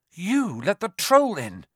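high, accusing question; astonished. A narrator's reading. Harry realises Quirrell freed the troll, astonished. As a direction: blurt it in disbelief.

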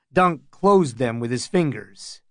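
ordinary delivery, nothing marked

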